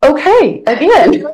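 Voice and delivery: loud, very high self-quote; retold exasperated reply.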